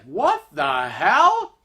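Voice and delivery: slow, drawn-out disbelief; baffled. Retold or imitated. Husband startled by a screaming stranger, baffled. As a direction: stretch each word in bewilderment.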